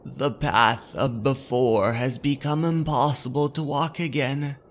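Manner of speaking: ordinary delivery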